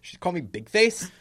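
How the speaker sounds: loud, offended protest; indignant. Acted. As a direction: protest the insult huffily.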